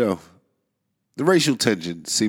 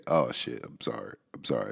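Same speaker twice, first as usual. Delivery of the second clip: sheepish apology; 7 semitones lower, 8 dB quieter than usual. Real, not acted. Apologizing after slipping the N-word.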